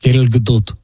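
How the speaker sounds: "Gal Gadot" said kel guh-DOOLT, low, Israeli accent. Shown as a demonstration. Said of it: "say it with an Israeli accent".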